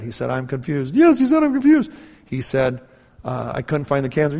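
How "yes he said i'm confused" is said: very high, loud mock-crying wife; tearful wife. Wife confirms surgeon's words to husband, high mock-tearful squeal. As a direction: squeal it out, imitating an overwhelmed wife. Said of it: "and she starts crying".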